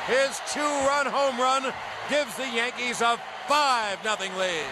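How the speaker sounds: rising play-by-play to a shout; home run call. Acted. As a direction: build the call to a roaring finish.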